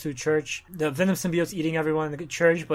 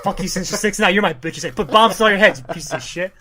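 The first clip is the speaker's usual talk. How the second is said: louder, raised-pitch mock tirade; acted angry taunting; 4 semitones higher, 7 dB louder than usual. Venom getting back at the Sinister Six, mock angry. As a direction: throw it out as a loud comic revenge rant.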